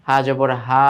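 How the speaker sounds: final হা stretched too long; drawn-out vowel. Shown as a demonstration. Teacher showing pupils what not to do, drawn-out vowel. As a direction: drag out the last হা, wrongly lingering. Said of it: "এইভাবে পড়া যাবে না".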